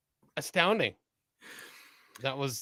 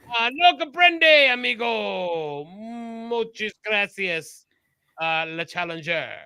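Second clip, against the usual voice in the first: mock Spanish accent; 4 semitones higher, slower than usual. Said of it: "this challenger went".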